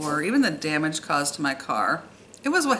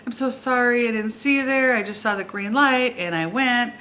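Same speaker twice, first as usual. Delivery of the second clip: higher-pitched retold distressed apology, no real tears; imitating crying apology; 6 semitones higher than usual. Shaken girl apologising to the driver she hit, mock-upset. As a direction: mimic a shaken apology in a raised voice. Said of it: "she's shaken up and she's crying".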